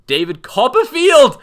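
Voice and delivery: loud laughing exclamation; laughing shout. Real, not acted. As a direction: shout the name through laughter. Shouting name while laughing.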